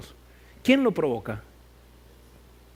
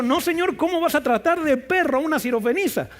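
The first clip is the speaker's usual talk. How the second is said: fast, much higher, indignant; indignant protest; 8 semitones higher than usual. Retold or imitated. Preacher voicing the disciples' ideal protest, fast and indignant. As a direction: protest the insult on the woman's behalf.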